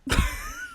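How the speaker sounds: high squeaky burst of laughter; helpless high-pitched laughing. Real, not acted. Amused after the corn story.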